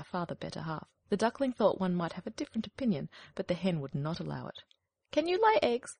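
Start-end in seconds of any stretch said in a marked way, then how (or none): 5.1-6.0 high, loud, bossy hen; snooty hen. Acted. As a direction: peck at the question like a proud hen. Hen questions duckling.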